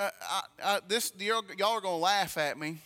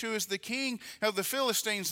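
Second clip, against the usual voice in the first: normal talking voice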